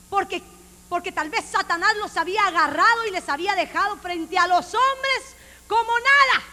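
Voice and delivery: loud, rising preacher's cry; fiery preaching. Acted. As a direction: preach it out at full force. Preacher rising to full force.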